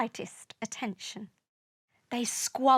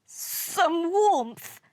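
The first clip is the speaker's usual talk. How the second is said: higher-pitched, mildly grumpy voice; grumbling; 6 semitones higher than usual. A narrator's reading. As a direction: grumble with longing. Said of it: "grumbled Uranus".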